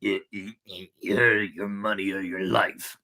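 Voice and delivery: horrid robber voices. A narrator's reading. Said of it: "two horrid voices".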